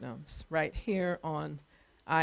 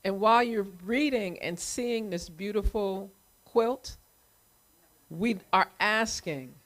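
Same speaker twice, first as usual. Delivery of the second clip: usual speaking voice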